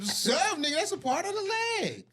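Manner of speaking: high, loud insistence; excited. Real, not acted. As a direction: push the point in disbelief. Heated leg argument.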